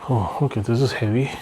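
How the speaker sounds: usual speaking voice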